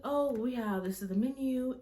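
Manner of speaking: imitated high, polite server voice; nice waitress. Waitress showing guests the menu, nice and high-pitched. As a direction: lift it into customer-service sweetness. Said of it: "she was being so nice".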